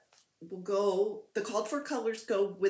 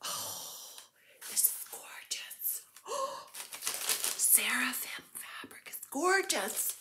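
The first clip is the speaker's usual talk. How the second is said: breathless whisper full of wonder; real awestruck whisper; awed whisper; 5 semitones higher, 7 dB quieter than usual. Real, not acted. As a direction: whisper it as if overwhelmed by beauty. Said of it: "I'm speechless".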